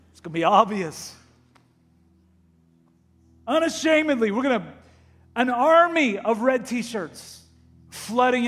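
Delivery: loud, high, urgent sermon delivery; full-force preaching. Acted. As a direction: preach it out to the whole room. Pastor preaching to congregation.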